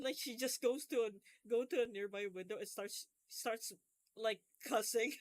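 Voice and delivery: ordinary talk, nothing marked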